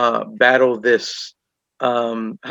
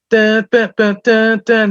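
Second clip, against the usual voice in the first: high, louder sung-out dun dun; mock dramatic music sting; 10 semitones higher, 5 dB louder, slower than usual. Mocking a Hollywood abortion scene with dramatic music.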